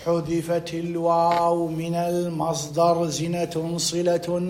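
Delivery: slow, stretched-out dictation; dictated conclusion. Shown as a demonstration. Teacher formulating the rule for the class, slow dictation. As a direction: draw the words out, building in the middle. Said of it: "إذن شو نقول؟".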